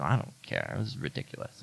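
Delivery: a mock-snobbish throwaway, low and quiet; snobby dismissal. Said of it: "kind of snobby and anti".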